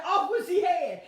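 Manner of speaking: high, loud hammed-up decree; playful executioner's order; mock royal shout. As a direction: bark it like an angry king. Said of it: "He could have said".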